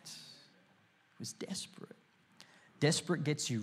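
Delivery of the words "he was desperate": whisper. Acted. Preacher drops to whisper.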